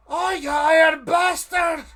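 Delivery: bellowed curse at the top of the lungs; furious shout. Acted. Tavern brawler roaring at the golem. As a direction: roar it across a silent room.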